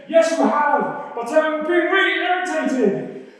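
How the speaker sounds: high, slow exasperated reply; irritated Balaam. Acted. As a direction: concede it, loudly exasperated. Acts Balaam answering the donkey.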